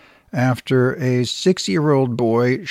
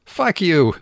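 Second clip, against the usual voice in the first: higher, louder mock comeback; 6 semitones higher, 4 dB louder than usual. Host voicing the boy answering his scolding, mock comeback. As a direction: snap it back like a defiant kid. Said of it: "the kid shoots back".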